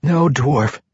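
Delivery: faint, strained monk's voice; weak voice. A narrator's reading. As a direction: speak with little strength left. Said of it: "the weak voice of Afa Frenfir".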